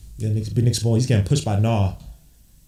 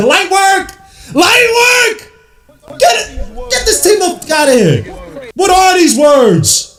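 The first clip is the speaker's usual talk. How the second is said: high, loud triumphant yelling; exultant fan shouting; hyped taunting; 8 dB louder, slower than usual. Real, not acted. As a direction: crow over the beaten team at full voice. Fan celebrating and taunting opponents.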